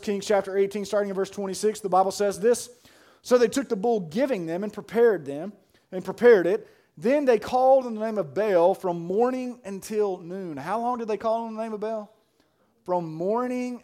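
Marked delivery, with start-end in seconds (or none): 3.2-10.6 raised, loud pulpit reading; preached scripture reading. A narrator's reading. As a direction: read the verse out with force.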